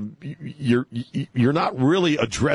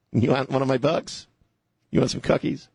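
lightly put-on higher voice offering cookies; mock Kamala; 4 semitones higher than usual. Host mocking Kamala, lightly put-on sweet voice. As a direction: tease her as a sugary hostess. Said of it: "Kamala, what was she saying".

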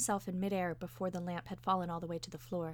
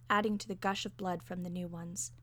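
plain reading voice

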